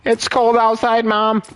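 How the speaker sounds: put-on high whiny kid; mock kid voice. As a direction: complain to mom like a child. Kid voice to mom.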